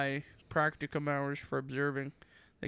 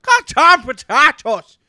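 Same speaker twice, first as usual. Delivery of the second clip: very loud, high, slow Irish-Scottish bellow; hammed-up Irish accent; 8 semitones higher, 16 dB louder, slower than usual. Riffing on his friend's potato joke, bellowed mock Irish accent. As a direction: bellow each word in a rough mock Irish brogue. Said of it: "my Irish voice".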